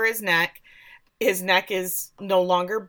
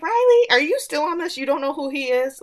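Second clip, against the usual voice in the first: higher, louder mock disbelief; exasperated; 8 semitones higher, 3 dB louder than usual. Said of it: "And I was like".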